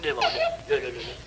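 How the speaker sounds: high, whining half-sob; man begging a baby to drink milk, sobbing; comic wailing plea. Acted. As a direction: beg the baby to drink, near tears.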